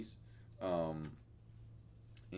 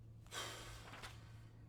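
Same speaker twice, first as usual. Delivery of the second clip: a deep, drawn-out unvoiced sigh; long sigh; 14 dB quieter than usual. Real, not acted. Vlogger undecided about his hair, heavy sigh. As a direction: let the air out slowly, weighed down. Pondering haircut decision.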